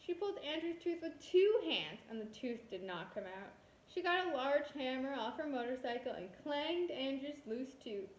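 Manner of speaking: ordinary delivery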